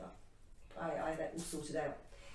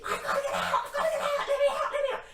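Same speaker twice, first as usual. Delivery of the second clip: very high, loud mock screaming; imitated hysterical screaming, half laughing; banshee scream; 7 dB louder, faster than usual. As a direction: shriek it like a panicking man trapped in a tunnel. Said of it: "started screaming like a banshee".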